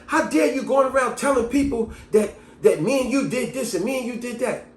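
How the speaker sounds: high-pitched indignant confrontation; going off. Retold or imitated. Girl confronts him in front of everybody, going off. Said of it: "she's going off on me".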